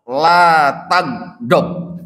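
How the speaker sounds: each syllable drawn out slowly; slow weighty Arabic phrase; slow solemn quote. Retold or imitated. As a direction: lay down the command slowly and gravely. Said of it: "dijawab ke Rasulullah".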